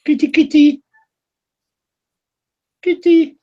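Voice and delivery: high sing-song cat call. Real, not acted. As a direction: call the kitty sweetly, high up. Calling the cat.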